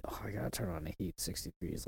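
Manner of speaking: soft breathy mock complaint; mocking whiny neighbor. Mocking the neighbors' complaint about sixty degrees, whiny and soft. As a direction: whine it under your breath. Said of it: "The hell you mean?".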